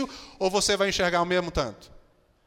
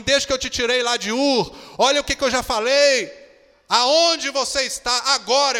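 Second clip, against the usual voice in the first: rising exhortation; 6 semitones higher, 8 dB louder than usual. Acted. Preacher voices God's exhortation.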